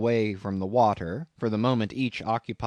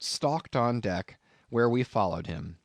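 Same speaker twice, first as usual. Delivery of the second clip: ordinary delivery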